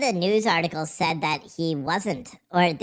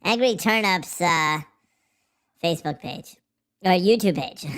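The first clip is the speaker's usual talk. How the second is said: ordinary talk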